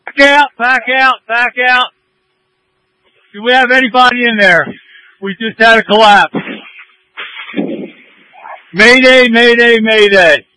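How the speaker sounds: high alarmed shout, real urgency; urgent shout. Real, not acted. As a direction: call the evacuation in real alarm. Collapse, commander calls mayday.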